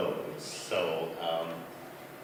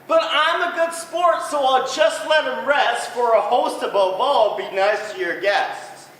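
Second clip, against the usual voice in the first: high, loud patient moose; a good sport putting up with pests; 7 dB louder than usual. Acted. Moose grumbles kindly.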